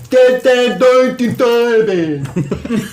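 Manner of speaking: mock garbled talk, falling at the end; pretend deaf speech. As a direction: imitate muffled speech in toneless syllables. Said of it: "we pretend to be deaf".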